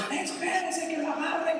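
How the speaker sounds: high, slow mock yell of a chasing mother; mom yelling. Mother chasing her son through the mall, yelling. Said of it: "persiguiendo al chino y gritando".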